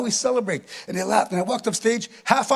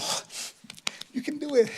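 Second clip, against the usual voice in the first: soft, higher cooing encouragement; kind encouragement; 6 semitones higher, 8 dB quieter than usual. Retold or imitated. Sisters encouraging the frozen comic, soft imitated kindness. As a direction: coax gently, voice up and small. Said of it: "they were so kind".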